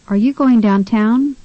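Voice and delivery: "downtown" stressed on TOWN, rising; second-syllable stress. Shown as a demonstration. Tutor models a dialogue question, stress on TOWN in "downtown". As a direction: ask it with the weight on the end of "downtown". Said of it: "second syllable in the word downtown is stressed".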